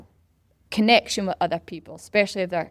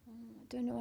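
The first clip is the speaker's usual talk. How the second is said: soft, higher, unsure mimicry; hesitant; 4 semitones higher, 16 dB quieter than usual. Newcomers unsure about joining in, hesitant. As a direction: shrink back softly in doubt.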